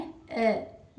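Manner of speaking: अ with the tongue tip touching the roof of the mouth; a drawn L sound, अ; phonic L sound. Shown as a demonstration. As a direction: hold अ as an L with the tongue up. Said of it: "touch करते हुए इसे बोलना है".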